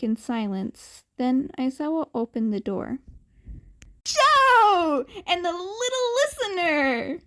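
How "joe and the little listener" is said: loud greeting. A narrator's reading. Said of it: "a loud human with yellow hair".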